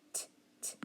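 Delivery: "t" as a soft voiceless tap, no vowel; two separate soft "t" clicks; sounding out. Shown as a demonstration. Teacher sounding out bottle, bare "t" sounds. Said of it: "what do you hear?".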